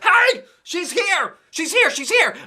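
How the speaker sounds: very high loud squeal; thrilled yell. A narrator's reading. Kid yelling to parents, very high and thrilled. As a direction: yell it down the house. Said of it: "I yelled real thrilled".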